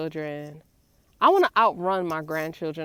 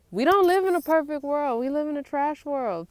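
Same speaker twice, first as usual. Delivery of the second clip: loud, higher, punching the words; emphatic; 7 semitones higher, 4 dB louder than usual. Real, not acted. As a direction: declare it hard. Emphatic complaint about the world.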